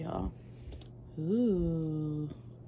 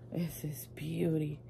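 awed whisper; 6 dB quieter than usual. Real, not acted. Vlogger whispering in awe over a palette.

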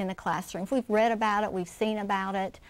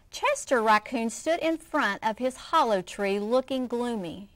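put-on lively read-aloud for children; expressive picture-book reading; story narration; 3 semitones higher than usual. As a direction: read it like story time for kids.

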